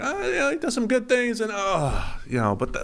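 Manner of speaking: high, strained hemming and hawing; noncommittal quarterback noises. Acted. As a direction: waver in a high voice over a so-so player. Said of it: "tier three quarterback noises".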